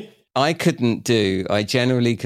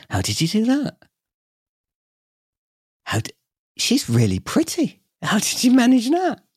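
much higher, louder mock amazement; amazed old boss; 3 dB louder than usual. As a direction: ham up the boss's disbelief. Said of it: "He was the first one to kind of".

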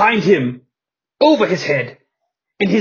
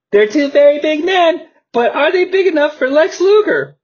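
high commentator voice; 9 semitones higher than usual. Acted. Commentator voice, pitched up.

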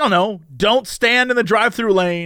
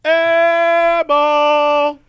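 calling for the ball; 8 semitones higher, 4 dB louder than usual. Retold or imitated. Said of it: "You're trying to be like".

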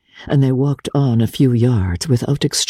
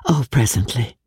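hushed aside. Acted. Ellen evading Archer's question.

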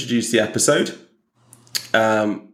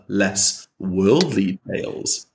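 ordinary talk, nothing marked

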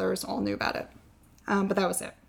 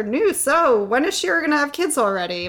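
higher, louder, sing-song prodding; nosy rabbi; 6 semitones higher, 5 dB louder than usual. Retold or imitated. Rabbi pressing a father about grandchildren, prodding and higher. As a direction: imitate a prodding rabbi's question. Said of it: "my rabbi says to my father".

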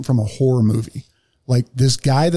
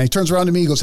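usual speaking voice

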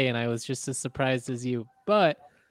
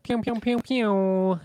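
pew pew noises; 9 semitones higher, slower than usual. Acted. Sound effect after the ad.

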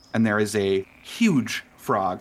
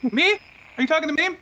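startled guest voice; 6 dB louder, much faster than usual. Acted. NPC guest startled, high voice.

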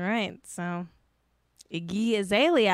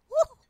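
high whoop; 4 dB louder than usual. Real, not acted. Host reacting to a mean chat joke, high whoop. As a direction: laugh into a very high "woo".

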